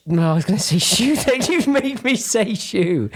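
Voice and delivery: high-pitched, laughing through the words; flustered laughing. Real, not acted. Host botches the guest's surname while introducing her, flustered laughing. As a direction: crack up at your own slip.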